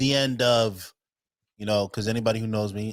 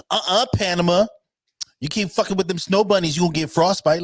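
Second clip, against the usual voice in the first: higher, louder mock scolding; tease-scold like a schoolmate, pitched up; imitated scolding schoolmate; 8 semitones higher, 4 dB louder than usual. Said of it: "I remember vividly in high school".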